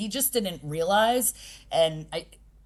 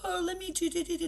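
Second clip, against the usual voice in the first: put-on much higher, softer cooing baby talk; mocking baby talk; 11 semitones higher, 5 dB quieter than usual. Coach mocking parents who baby their kids, high cooing. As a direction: coo it like a doting parent babying a child. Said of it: "They're like".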